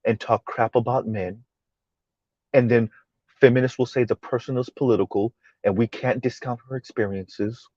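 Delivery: very soft, low, measured imitation; mock calm tone. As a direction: feign gentle composure while complaining. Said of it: "speak like this".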